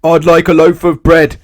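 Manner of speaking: loud, high shout. A narrator's reading. Mister Noisy shouts because she pretends not to hear. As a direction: shout it at her. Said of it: "Mister Noisy shouted".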